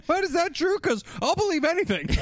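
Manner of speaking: high, teasing imitation of credulity; hammed-up naive surprise; mock gullible. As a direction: parody someone who falls for everything. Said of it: "He's so gullible".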